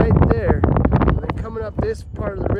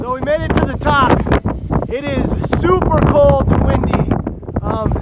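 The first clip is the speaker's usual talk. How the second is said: wind on mic; 7 semitones higher, 4 dB louder than usual. Real, not acted. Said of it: "sorry for the wind sound".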